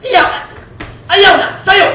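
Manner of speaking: high, loud sharp yell; loud high mock yell of stop it; angry scolding. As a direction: shout down the little mistake. Acted scolding someone.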